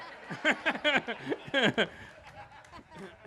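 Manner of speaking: loud, high, drawn-out hearty laughter; long hearty laugh. Real, not acted. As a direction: laugh fully at your own joke. Father laughs at own joke.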